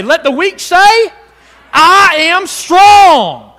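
"strong" drawn out, loud and high; proclaiming loudly. Shown as a demonstration. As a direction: declare "I am strong" with full force. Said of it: "What does he say?".

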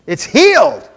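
loud, high-pitched burst of amazement; astonished exclamation. Retold or imitated. Healed man telling the one who prayed, astonished exclamation. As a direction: exclaim it at full volume.

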